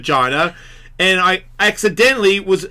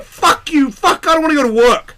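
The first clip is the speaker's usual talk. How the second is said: high, louder petulant rant; frustrated outburst; 9 semitones higher, 4 dB louder than usual. Retold or imitated. Man ranting to himself about his wife, petulant and high. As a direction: throw a sulky little tantrum.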